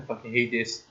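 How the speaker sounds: low, quiet grumble; muttered frustration. Real, not acted. Player hating having to redo the chase, low muttered frustration. Same words muttered, then loud.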